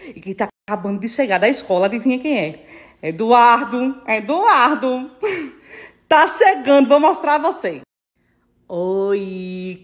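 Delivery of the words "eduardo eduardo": slow, drawn-out call of a name. Real, not acted. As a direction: stretch the name out, calling him over. Calling son home from school. (chamando o nome bem arrastado)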